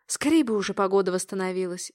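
plain reading voice

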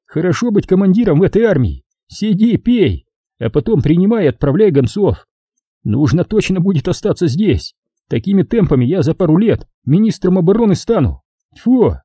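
high, scornful sneer ending in spit; mock the drinking bosses; sarcastic. Sashka sneers at commanders.

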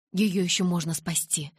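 ordinary delivery, nothing marked

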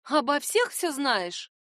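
rising excited admiration; admiring. A narrator's reading. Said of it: "восхитилась Райера".

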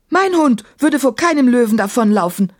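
emphatic, offended retort; indignant call. A narrator's reading. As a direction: call out in defence. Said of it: "rief Emma".

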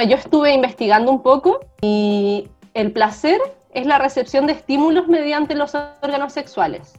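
usual speaking voice

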